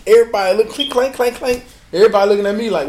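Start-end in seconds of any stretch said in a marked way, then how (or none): 0.7-1.6 high, ringing clink-clank; sound-effect storytelling; imitated clatter. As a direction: mimic plates clattering in a raised pitch. Imitating plates and silverware clattering.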